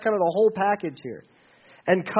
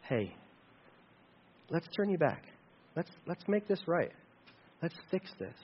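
softer, tender reassuring offer; gentle helper; 11 dB quieter than usual. Pretend. Friend gently offering to help a struggling believer, soft and tender. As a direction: coax someone back gently, almost hushed. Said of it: "their attitude should be".

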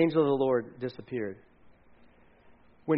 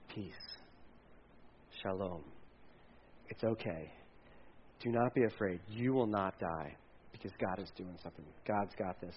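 deep calm reassurance; 9 semitones lower, 16 dB quieter than usual. Acted. God comforting Gideon, hushed and low. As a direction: lay "Peace" down like a hand on the shoulder. True shalom set against hippie.

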